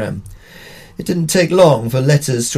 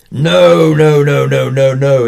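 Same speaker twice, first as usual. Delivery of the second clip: loud, blustering no, no, no, fading away; exploding major; 6 dB louder, slower than usual. Retold or imitated. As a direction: bluster like a crusty old major. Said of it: "exploded the crusty major".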